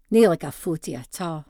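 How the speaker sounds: ordinary delivery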